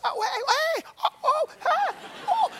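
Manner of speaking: very high, delighted hey-oh greetings; greeting friends at a party, squealing high. Retold or imitated. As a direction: spot old friends across a party and squeal. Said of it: "lots of pointing".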